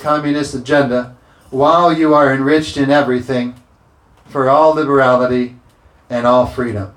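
loud, driving scripture preaching; full-force preaching. Acted. Pastor pushing scripture at the congregation, loud preaching. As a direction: preach it out over the room.

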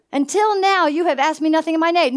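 fast run through Until now. Acted. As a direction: rattle off the verse the second time. Same verse repeated faster.

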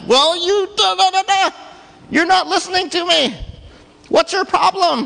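high loud squabbling; arguing back. Retold or imitated. Balaam arguing with his donkey, high loud squabble. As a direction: bicker like a fed-up rider. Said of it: "starts talking back to the donkey".

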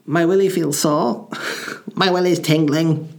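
put-on high-pitched little boy. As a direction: put on a small boy's high voice. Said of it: "when I was a little boy".

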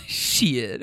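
usual speaking voice

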